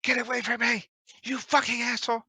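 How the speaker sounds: high-pitched scream, acted without full volume; game master voicing a woman screaming to get away; mock scream. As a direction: scream it high but held back. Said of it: "you hear screaming like".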